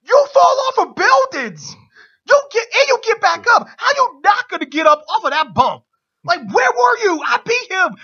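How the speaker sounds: high, loud furious yelling at the ref; retold scream. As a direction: rage at the ref like a cheated villain. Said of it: "Owens then screams at Shane".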